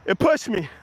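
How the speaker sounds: ordinary talk, nothing marked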